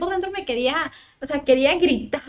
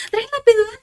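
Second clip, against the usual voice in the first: doctor calling out high and loud; urgent doctor's order; 9 semitones higher, 6 dB louder than usual. Retold or imitated. Doctor orders staff for epidural, loud urgent call. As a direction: bark it out as the doctor.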